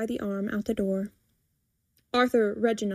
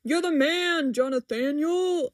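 higher, put-on cheering voice; chorus cheer; 5 semitones higher than usual. A narrator's reading. Customers thanking the man for cookies, cheering. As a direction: cheer him on in a raised pitch. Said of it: "A small chorus rings out".

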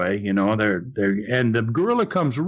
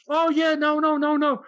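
crowd protesting; 12 semitones higher than usual. Retold or imitated. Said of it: "the crowd goes".

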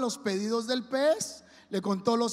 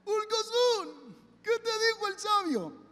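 voz aguda y lenta de pez que grita; un pececito que le grita al holgazán; pez gritando; 9 semitones higher, slower than usual. Acted. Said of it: "el pez salió gritándole". (el pez le grita al holgazán con voz aguda)

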